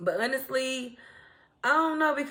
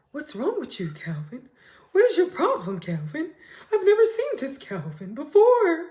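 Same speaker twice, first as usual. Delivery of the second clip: half-shouted disbelief, higher on the questions; shocked exclaiming; 3 semitones higher than usual. Retold or imitated. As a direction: act shocked at your date's outburst. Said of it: "she like".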